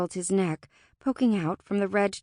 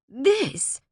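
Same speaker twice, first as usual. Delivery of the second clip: higher, exasperated outburst; 6 semitones higher, 4 dB louder than usual. A narrator's reading. Fae gestures over the frosty landscape, exasperated. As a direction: throw the word out in accusation.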